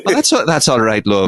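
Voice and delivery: a hint of a Yorkshire chicken saying love; Yorkshire accent. Quoting the Chicken Run chickens in a mild Yorkshire accent. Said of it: "speaking in a Yorkshire accent".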